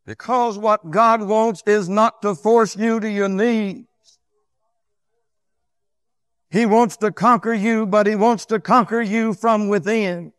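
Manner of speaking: loud, high pulpit delivery; full-force preaching. Real, not acted. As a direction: preach it out strongly, high and emphatic.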